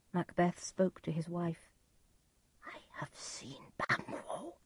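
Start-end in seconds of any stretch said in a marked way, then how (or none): 2.7-4.6 hushed, much softer whisper; low voice. A narrator's reading. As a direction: whisper it to your wife. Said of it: "In a low voice".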